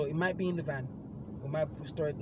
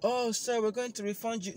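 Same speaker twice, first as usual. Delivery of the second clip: apologetic agent; 8 semitones higher, 3 dB louder than usual. Retold or imitated. Agent voiced in story.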